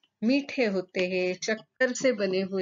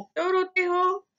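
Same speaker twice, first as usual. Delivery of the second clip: high, raised queen's voice asking sharply; queen's stern question; 9 semitones higher than usual. Acted. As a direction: ask like an annoyed queen. रात रानी बच्चों से पूछती है. (ऊँची आवाज़ में रानी का कड़ा सवाल)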